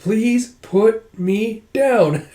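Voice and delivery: high, very slow plea; drawn-out plea. Acted. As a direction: plead word by word, stretching each one. Princess pleads to be put down.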